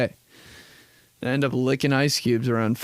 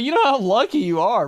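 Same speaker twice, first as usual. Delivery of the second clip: 1 envious exclaim; 11 semitones higher, 3 dB louder than usual. Retold or imitated. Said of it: "I was like".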